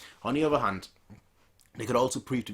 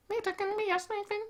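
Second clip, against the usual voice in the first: much higher, airy foreign accent on Fate can be a strange thing; put-on foreign accent. Shown as a demonstration. Dubbing the mountain tribe leader, higher put-on foreign accent. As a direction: glide up into an odd foreign lilt. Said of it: "I gotta put on, like, a foreign accent now".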